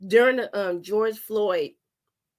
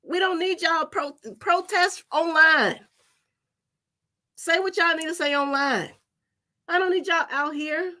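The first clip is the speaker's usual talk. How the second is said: pleading mom; 8 semitones higher than usual. Retold or imitated. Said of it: "my heart just was like".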